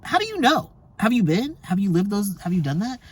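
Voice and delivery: high, pointed questions; sharp disbelieving retort; incredulous challenge. Retold or imitated. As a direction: fire back at the doubters. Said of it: "I'm like".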